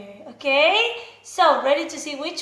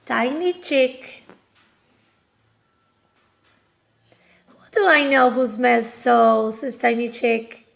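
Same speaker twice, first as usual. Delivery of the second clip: put-on reading to children; 4 semitones lower, 4 dB louder than usual. A narrator's reading. Teacher reading a farm story to little children. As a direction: read it out like a picture book for toddlers. Reads picture book to kids.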